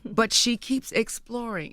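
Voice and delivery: ordinary talk, nothing marked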